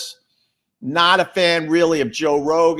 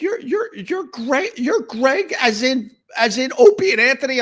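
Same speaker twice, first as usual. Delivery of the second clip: excited fan; 13 semitones higher, 5 dB louder than usual. Retold or imitated. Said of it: "He's like".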